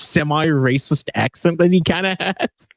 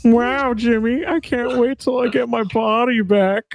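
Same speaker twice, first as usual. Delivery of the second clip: mock voice; 8 semitones higher, slower than usual. Imitating Alphonse in cartoon mashup.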